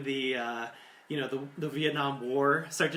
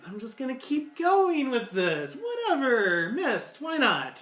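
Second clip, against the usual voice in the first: higher, louder, theatrical shrug; over-the-top actor going along, higher and louder; hammy actor; 10 semitones higher, 5 dB louder than usual. Acted. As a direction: ham it up like a stage actor rolling with it. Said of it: "the character decides".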